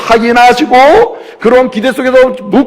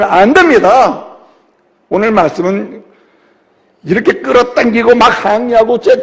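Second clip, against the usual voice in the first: ordinary delivery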